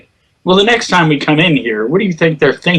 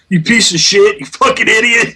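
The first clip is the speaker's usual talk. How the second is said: higher, hammed-up angry insult; mock insult at teammate; 9 semitones higher than usual. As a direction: play the toxic teammate losing it. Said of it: "don't look at your teammate and go".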